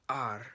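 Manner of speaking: ar said alone, loud and pushed; stressed first syllable ar. Shown as a demonstration. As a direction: hit the opening "ar" hard. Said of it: "the stress is at the front of the word".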